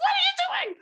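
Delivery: very high, loud shocked squeal; shocked waitress squeal. Retold or imitated. Waitress catches a woman showing pig videos, shrieking in shock.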